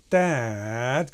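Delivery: long DAD with a dipping tone; falling emphasis. Retold or imitated. Animator shows where to squash, DAD said with a falling, drawn-out tone. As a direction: lean on DAD and draw it downward. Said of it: "going down".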